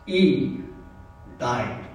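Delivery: low, hushed and halting, with long pauses; solemn epitaph. Retold or imitated. Farmer's epitaph for the man who died in his place, solemn and hushed.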